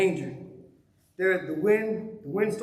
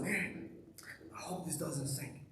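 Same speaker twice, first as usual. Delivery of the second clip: worried murmur; 5 semitones lower, 16 dB quieter than usual. Retold or imitated. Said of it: "I looked out and said".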